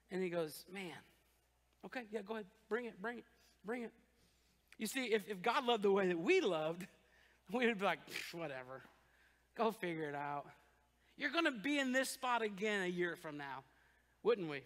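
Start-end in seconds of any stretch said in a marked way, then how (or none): 0.7-3.9 weary God. Retold or imitated. 8.1-10.4 dismissive brush-off. Retold or imitated. Dismissive God.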